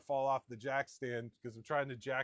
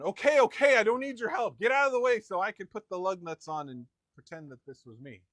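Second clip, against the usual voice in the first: imitating wife, much higher and louder, shooing him away; mock bossy brush-off; 5 semitones higher, 11 dB louder than usual. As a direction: wave him off and grab the credit. Wife taking over the job.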